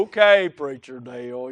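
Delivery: low, slow, plodding drawl; Eeyore. Retold or imitated. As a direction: drag it out as a slow talker. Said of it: "He reminded me of Eeyore".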